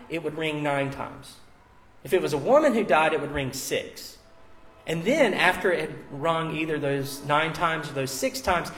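ordinary delivery

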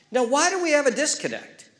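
fiery pulpit climax; impassioned preaching. Real, not acted. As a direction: preach at full force. Preacher builds to exhortation.

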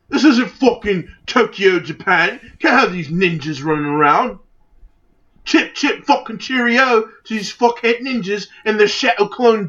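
hammed-up British grumbling; bad British accent. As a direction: rant like an outraged cartoon Brit. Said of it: "This is the worst British accent".

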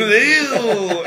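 loud, high, drawn-out squeal of disgust. Acted. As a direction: recoil with a long high "ew". Mock disgusted reaction to crude proposition.

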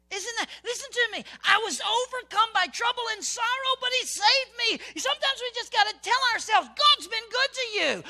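high, loud fervent preaching; full-force preaching. Real, not acted. Preacher urging the congregation to preach to themselves, fervent. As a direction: preach it to yourself with fire.

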